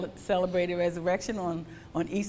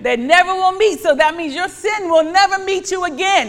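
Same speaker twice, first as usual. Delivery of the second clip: louder and higher, driving each phrase home; impassioned preaching; 7 semitones higher, 9 dB louder than usual. Acted. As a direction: preach it out to the congregation. Preaching at full force.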